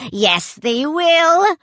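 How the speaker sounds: slow, loud, high retort; the other head arguing with the first; contrary sailor. A narrator's reading. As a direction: contradict him stubbornly.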